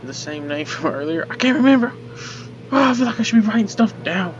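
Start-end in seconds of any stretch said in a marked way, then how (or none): 1.3-4.3 high, loud frantic burst; flustered outburst. Real, not acted. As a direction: blurt it out in panic. Frantic, lost in puzzle.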